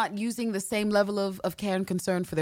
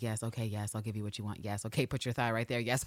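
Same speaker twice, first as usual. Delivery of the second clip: soft, much quieter meek yeses; meek compliance; 8 semitones lower, 9 dB quieter than usual. Retold or imitated. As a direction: give in softly like a pushover. Voicing meek submissive Eve.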